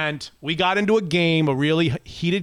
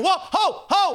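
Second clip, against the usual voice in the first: mock protest; 12 semitones higher, slower than usual. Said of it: "the other team's like going".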